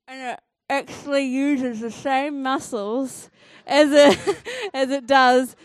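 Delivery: talking around a pen held in the teeth; pen in mouth. Real, not acted. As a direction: speak with a pen clamped in your mouth. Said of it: "I'm gonna put this in my mouth".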